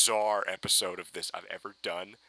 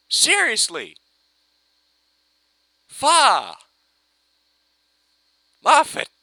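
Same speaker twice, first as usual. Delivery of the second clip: loud, high exasperated shout; shouting at Moffat, beaten; exasperated yell; 12 semitones higher, 10 dB louder, much slower than usual. Real, not acted. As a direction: yell at the writer in defeat. Frustrated outburst at the writer.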